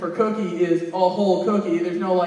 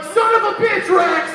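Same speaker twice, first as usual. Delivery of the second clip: angry yell; 10 semitones higher, 9 dB louder than usual. Retold or imitated. Furious outburst at friend Rex.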